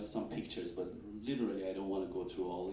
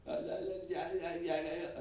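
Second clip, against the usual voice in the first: high, halting stammered yeahs; mock flustered stammer; imitating stammer; 9 semitones higher, faster than usual. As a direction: stutter like a stunned colleague. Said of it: "this is how he was talking".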